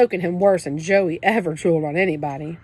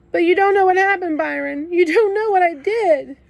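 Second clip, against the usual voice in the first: sobbing protest; 11 semitones higher, 6 dB louder than usual. A narrator's reading. Kenny sobbing to his brother.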